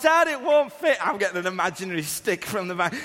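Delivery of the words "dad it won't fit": high, loud urgent call; urgent little boy. Retold or imitated. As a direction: call out like a small boy in alarm. Son struggling with imaginary lion.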